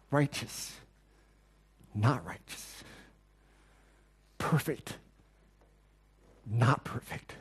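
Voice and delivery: low, soft word pairs with long pauses; spaced-out pairs, hushed and deliberate; stark contrast. Shown as a demonstration. Contrasts God with man in pairs.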